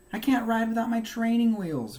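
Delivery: high, loud complaining whine; groaning. A narrator's reading. Franklin to his mother, whining groan about training wheels. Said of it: "groaned Franklin".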